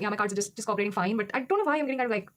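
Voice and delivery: usual speaking voice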